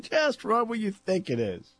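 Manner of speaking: high-pitched repeat of a mock holler; toned-down echo of the yelled call; imitated call. Repeats the retold yell, amused.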